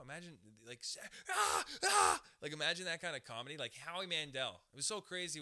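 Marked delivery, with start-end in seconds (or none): 1.3-2.2 very loud, high-pitched screams; Sam Kinison scream. Retold or imitated. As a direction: scream it out in two huge bursts. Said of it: "Imagine Sam Kinison right now".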